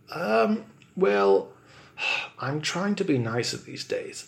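ordinary delivery

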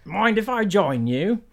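Nigel voice. Acted. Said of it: "That was pretty good".

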